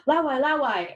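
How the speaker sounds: mock childish excitement; kids pointing out foreigner. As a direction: mimic a child spotting something amazing. Acting kids spotting a foreigner.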